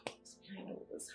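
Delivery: whispered to herself; worried woman whispering to herself. Acted. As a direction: breathe the question out anxiously.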